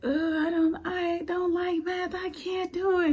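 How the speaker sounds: put-on high, whiny child voice complaining about math; whiny frustrated daughter. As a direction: play the fed-up nine-year-old. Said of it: "she was frustrated with our homework".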